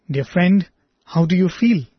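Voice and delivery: usual speaking voice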